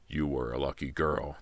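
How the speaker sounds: low, soft villain threat; quiet menace from a strangler; low menacing voice. Acted. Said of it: "the man said in a low voice".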